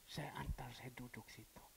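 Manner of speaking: put-on hushed voice for a frail old woman; soft whisper. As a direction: breathe the words to her gently. Quoted gentle words to widow.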